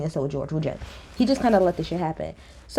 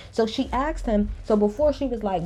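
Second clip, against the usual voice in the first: normal talking voice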